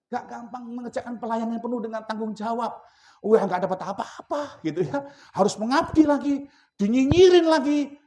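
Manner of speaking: high, loud whiny grumbling voice; mock complainer. Preacher mocking a grumbling church worker, whiny and loud. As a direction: whine it like a tired servant who gets nothing.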